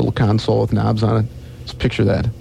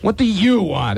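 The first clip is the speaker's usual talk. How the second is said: annoyed bartender; 10 semitones higher, slower than usual. Acted. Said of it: "asked the bartender".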